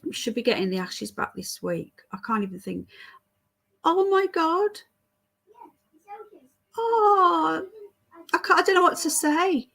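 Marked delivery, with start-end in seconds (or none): 3.8-9.7 high-pitched, overwhelmed gratitude; so touched you are lost for words, voice lifting high; real tearful disbelief at a kindness; moved near tears. Real, not acted. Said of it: "I'm gonna cry".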